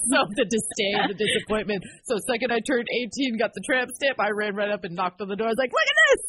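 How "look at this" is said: high, loud show-off shout; gleeful mock yell; excited shout. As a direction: flaunt it like a proud teen. Showing mom the tattoo, retold.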